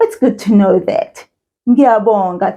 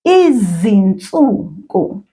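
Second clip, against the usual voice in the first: "Izinsuku" drawn out slowly as one word; slow model for repeating; 3 semitones lower, slower than usual. Shown as a demonstration. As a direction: stretch "Izinsuku" slowly for a learner to copy. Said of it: "ask you to repeat this after me".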